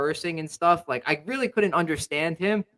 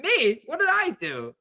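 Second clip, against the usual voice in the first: much higher, bewildered protest; baffled innocence; 12 semitones higher than usual. Retold or imitated. Speaker retelling his confusion after being threatened, high, baffled protest. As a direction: play the innocent, completely confused. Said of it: "I was like".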